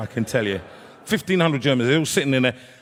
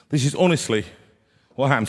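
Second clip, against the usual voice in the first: ordinary talk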